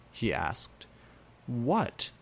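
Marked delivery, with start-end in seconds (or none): none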